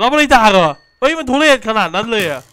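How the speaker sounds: loud, high burst of disbelief; can't believe how shameless it is; real outrage, voice jumping high and loud; shocked disbelief. Real, not acted. Shocked at donated old trains.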